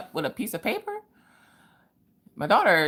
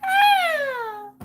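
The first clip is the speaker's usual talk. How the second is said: high excited squeal; 4 dB louder than usual. Real, not acted. Excited greeting of a viewer.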